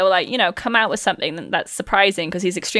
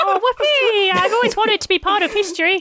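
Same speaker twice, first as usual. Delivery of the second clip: high, thrilled little urchin, much higher; plucky hopeful orphan; 10 semitones higher than usual. Acted. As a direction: cheer it like an eager orphan. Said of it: "Victorian orphan voice".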